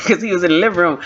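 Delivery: ordinary delivery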